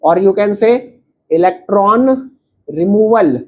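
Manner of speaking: every word spelled out slowly for students to copy; slow dictation. Acted. Teacher dictating the definition to students, very slow. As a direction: dictate it word by word so they can write.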